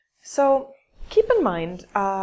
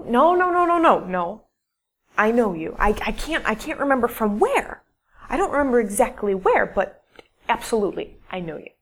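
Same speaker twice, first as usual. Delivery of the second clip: emphatic insistence; 6 dB louder, faster than usual. Retold or imitated. Retold stranger insisting she knows her.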